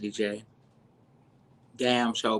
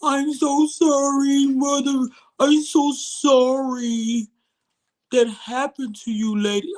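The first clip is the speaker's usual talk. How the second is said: high, very slow, syrupy fake apology; imitating Mackenzie's fake condolences, high and slow; mock sympathy; 11 semitones higher, 5 dB louder, slower than usual. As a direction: drag it out like fake pity. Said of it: "Mackenzie brought her one-eyed ass up there".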